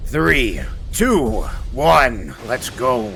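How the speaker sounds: growly orc countdown. Pretend. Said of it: "That was my orc impression".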